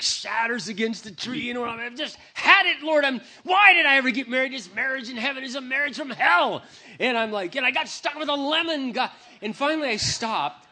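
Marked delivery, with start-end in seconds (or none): 1.9-6.6 high, loud shouting at God; fed-up husband yelling his complaint; furious rant. Retold or imitated. Ranting at God in woods.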